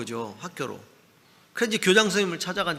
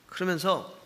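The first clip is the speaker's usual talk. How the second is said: usual speaking voice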